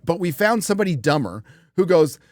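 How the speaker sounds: ordinary delivery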